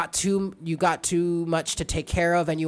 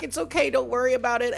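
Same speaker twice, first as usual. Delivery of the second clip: cat's voice; 8 semitones higher, faster than usual. Retold or imitated. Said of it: "she was like".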